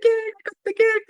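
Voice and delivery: high, loud, ringing cry of joy; jubilant shout. Retold or imitated. After hearing he got the film, jubilant high shout. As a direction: shout it to the snowy street. Retold rushing out barefoot after news.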